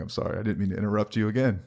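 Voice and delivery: ordinary talk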